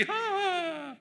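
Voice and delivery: high mock cackle of the enemy; mock laugh. Enemy laughing at cards in the coffee stall, high mock laugh. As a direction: ham up his carefree laugh. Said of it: "Satu ketawa ke T V".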